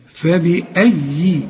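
فبأي slow with a doubled, stressed yaa; tajweed demo. Shown as a demonstration. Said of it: "إن الياء دي مشددة".

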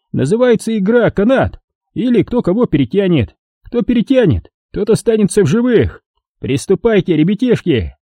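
put-on high, gloating old man voice; a cruel old miner mocking his victim; old prospector. A narrator's reading. Old man sets up game.

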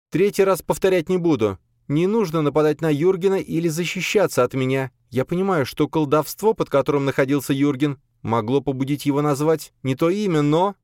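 high-pitched authoritative woman's voice; imperious mistress, explaining firmly; stern lady of the house. Acted. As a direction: lay down the law in a high, firm voice. Narrator voices stern lady Kozhemera.

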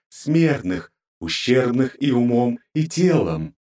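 high, contemptuous divine scorn; terrifying goddess voice. Acted. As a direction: tear the air with disdain. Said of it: "Жуткий, буквально раздирающий воздух голос".

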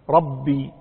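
ربي with a firm doubled B; shadda on the B. Shown as a demonstration. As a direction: close and hold the B in ربي. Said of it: "الباء".

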